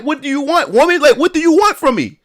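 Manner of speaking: high, frustrated what do you want; exasperated plea. Retold or imitated. To his baby mom, high exasperated demand. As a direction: throw up your hands at her.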